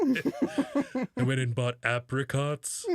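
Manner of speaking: drawn-out, exaggerated "apricots" to needle; mock irritating. Host mimicking cohost's pronunciation of apricots, mock irritating. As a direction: slowly overdo it to annoy. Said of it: "you're trying to say it, uh, irritatingly".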